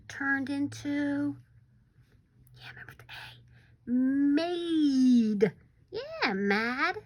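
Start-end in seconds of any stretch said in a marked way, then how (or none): 2.6-3.9 whispered hint. Real, not acted.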